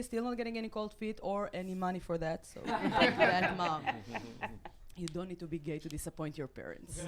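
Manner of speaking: usual speaking voice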